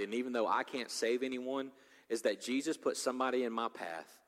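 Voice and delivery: usual speaking voice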